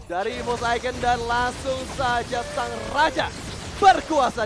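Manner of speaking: hyped play-by-play caster; fighting game announcer. Acted. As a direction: call the wipe out like an arena announcer. Live play-by-play during teamfight.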